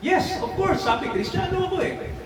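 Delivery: lifted, eager reply in a high voice; proud high answer. Retold or imitated.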